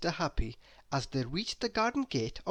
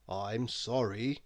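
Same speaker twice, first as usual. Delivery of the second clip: lower, slow and soft apology; sorry; 5 semitones lower, 4 dB quieter, slower than usual. A narrator's reading. Doctor Makewell apologises to Miss Giggles, sorry and soft. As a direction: gently break bad news. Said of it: "he added".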